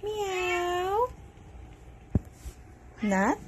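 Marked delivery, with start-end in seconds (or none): 0.0-1.1 high, soft, drawn-out meows; playful human meowing; cat imitation. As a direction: meow softly to the cat.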